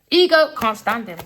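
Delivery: emphatic repeat. Real, not acted. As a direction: hammer it home loudly. Repeats it with force.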